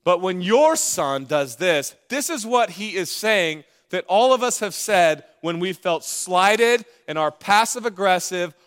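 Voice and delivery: ordinary talk, nothing marked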